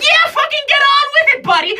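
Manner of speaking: very high, loud, exasperated genie; sassy genie. Acted. Genie scoffing at the comic who never met one, very high. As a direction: snap at them as an annoyed genie. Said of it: "She's like".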